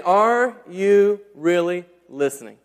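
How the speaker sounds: slow, earnest question. Retold or imitated. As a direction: ask it slowly, searching. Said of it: "Jesus is saying".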